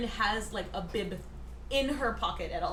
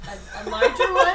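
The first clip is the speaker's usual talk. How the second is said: laughing; 7 semitones higher, 10 dB louder than usual. Acted. Joking offer, laughing.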